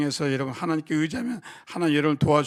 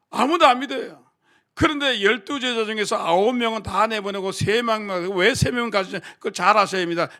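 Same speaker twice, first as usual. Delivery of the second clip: loud, higher preaching, pressing the point; full-force preaching; 4 semitones higher, 7 dB louder than usual. Acted. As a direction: preach it out to the whole congregation.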